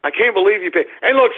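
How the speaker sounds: normal talking voice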